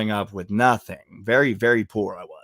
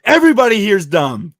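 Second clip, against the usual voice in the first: high, loud blurt; retold outburst; 9 semitones higher, 9 dB louder than usual. Telling off the protest crowd, loud outburst. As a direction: shout it out at the whole crowd.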